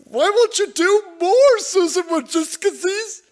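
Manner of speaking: hammed-up Nadler impression, pitched high; mocking Jerry Nadler. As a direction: squeal it like a panicked politician. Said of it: "Jerry Nadler running around telling everybody".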